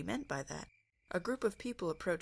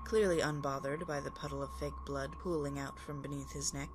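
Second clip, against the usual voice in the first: plain reading voice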